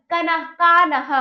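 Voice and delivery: कान्हा as ka-na-haa; wrong full न. Shown as a demonstration. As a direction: put a vowel after न in कान्हा. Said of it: "पूरा ना होता तो गलत हो जाता".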